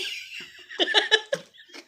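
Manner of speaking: airless, wheezing burst of laughter; breathless laugh. Real, not acted. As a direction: laugh it out without voice. Laughing at own dare story.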